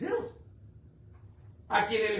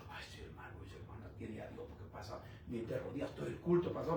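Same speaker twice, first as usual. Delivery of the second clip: mock admiring churchgoer; 6 semitones lower, 9 dB quieter than usual. Voicing gullible onlookers admiring the worshipper.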